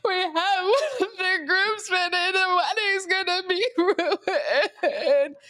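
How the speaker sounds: high, slow, hammed-up wailing sob; fake crying. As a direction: fake a dramatic sob to win the clerk over. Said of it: "started fake crying".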